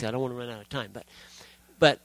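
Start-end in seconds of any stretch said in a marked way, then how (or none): 0.0-0.9 hurried aside, trailing off softer; talking fast. Real, not acted. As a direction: rush it to save time. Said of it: "I'm talking fast".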